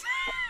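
squeaky high burst of laughter; shrieking laugh. Real, not acted. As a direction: cackle at your own slip. Realizes a slip of tongue.

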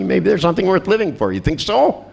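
high, singsong leading questions; mock coaxing. Therapist nudging scared patient toward living, high mock coaxing.

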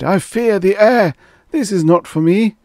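high, loud pleading refusal; fearful cry. A narrator's reading. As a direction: cry out your fear of it. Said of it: "he cried".

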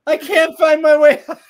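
high, loud, laughing mock panic; panicked mock cry. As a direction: yell it lost in a mountain of hair. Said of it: "she's like".

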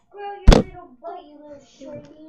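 loud mocking taunt; mean commenter. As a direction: shout a rude jab. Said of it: "those mean comments where it's like".

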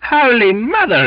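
high, loud exclamation; heaving, high-pitched oath; exclaiming. A narrator's reading. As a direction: cry out under strain. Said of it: "exclaimed Belfast".

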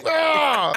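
high strangled cry; hammed-up angry yelp; mock rage squeal. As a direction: squeal through clenched fury.

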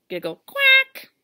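high loud duck quack; duck giggle. Acted. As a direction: squawk it like a cheeky duck. Duck's voice in refrain.

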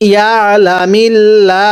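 ইয়া লা মিল লা slow, ending in a long open লা; light lam recitation. Shown as a demonstration. Teacher models Allah after jer, light lam. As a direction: finish on a light, stretched লা. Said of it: "লা করে পড়বেন".

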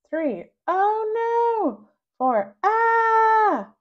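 very high, slow, loud panicked cry; rising panic. Acted. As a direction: dread building to a shout. Counting down to the shot.

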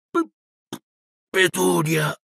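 breathless stammer of fear; gasping in shock. A narrator's reading. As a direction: choke out the name in fright. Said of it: "ansimò".

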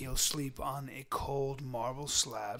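very slow, soft epic narration; low-volume film prophecy, word by word; Uncle Angus from Braveheart. Acted. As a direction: intone it gravely, hushed and drawn out. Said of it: "you sound like Uncle Angus from".

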